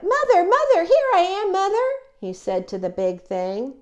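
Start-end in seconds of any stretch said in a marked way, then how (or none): 0.0-1.9 put-on very high, loud baby bird cry; piping, thrilled little bird; excited calling. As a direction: call eagerly to get noticed. Bird calls to big thing.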